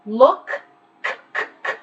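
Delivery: "Look" then a clipped unvoiced "k, k, k"; k sound demo. Shown as a demonstration. Teacher isolates final k for students. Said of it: "verbs ending in a k".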